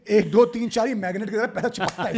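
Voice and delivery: enjoy the joke; laughing tease. Real, not acted.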